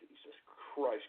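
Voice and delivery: low, slow and very soft; exasperated mutter; weary muttered curse. Real, not acted. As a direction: swear under your breath, worn out. Weary swearing after outburst.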